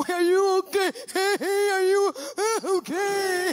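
high, wailing check-in; hammed-up panicked calling; frantic concern. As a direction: imitate a friend fretting in a high voice. Friend frantic outside the shower.

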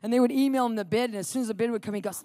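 usual speaking voice

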